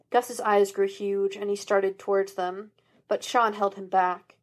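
ordinary delivery

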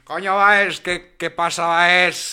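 mock Caribbean. Teasing the new guest Báez in a mock Caribbean accent. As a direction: hail Báez like a hammed-up Caribbean pal.

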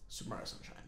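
whispered, very soft; barely voiced whisper; hushed aside. Real, not acted. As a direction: slip it in under your breath. Hushed aside answering own question.

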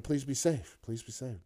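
usual speaking voice

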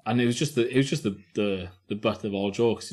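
ordinary talk

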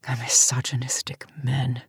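a scornful breath of a word; bitter whisper. Acted. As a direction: whisper it to yourself with contempt. Janice alone, brooding on the board.

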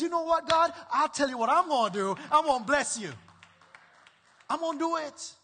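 loud, high preacher voice building into a vow; preaching at full force. Acted. Preacher voicing Zacchaeus's vow to God, loud and high. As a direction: proclaim it like a rousing sermon.

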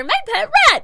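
shouted high like a grand unveiling; acted-out proud proclamation; triumphant reveal. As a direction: announce it loud and high. Acting out lifting the rat up.

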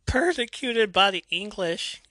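high, loud, drawn-out declamation; milk every word with mock melodrama; dramatic. Imitating Liberty Bell's entrance line, very dramatic. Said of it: "it's so dramatic".

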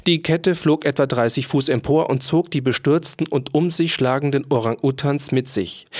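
ordinary delivery, nothing marked

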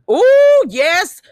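high, loud squeal of delight; a gleeful ooh at the joke. Real, not acted. Co-host reacting to "dry rubbers", squealing ooh.

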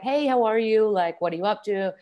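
put-on light, chatty American accent; normal American accent. Guest imitates normal Americans chit-chatting, American accent. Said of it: "normal Americans are just like".

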